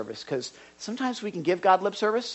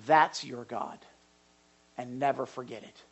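ordinary talk, nothing marked

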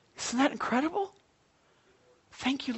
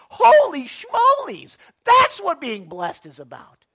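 real preacher's excitement, loud and higher; excited yelling; 4 semitones higher, 7 dB louder than usual. Real, not acted. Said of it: "I'm getting excited and I'm yelling".

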